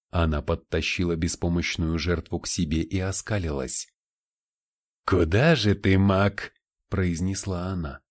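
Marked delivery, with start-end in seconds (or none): 5.0-6.5 taunting demon. Acted. Demon taunts captured mage.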